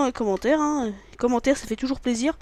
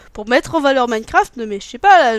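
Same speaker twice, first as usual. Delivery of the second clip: louder, rising heated talk; excited outburst; 10 dB louder than usual. Real, not acted. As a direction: get worked up.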